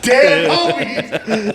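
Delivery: high, slow laugh through the words. Real, not acted. Laughing at his own Forrest Gump joke, slow and high. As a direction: crack up at your own line.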